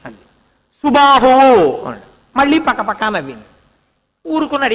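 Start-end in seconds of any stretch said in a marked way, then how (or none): 0.8-1.8 ringing announcement with a drawn-out ending; name proclamation. Acted. King naming his second son to his wife, proclaiming.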